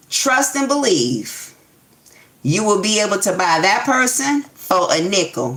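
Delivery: normal talking voice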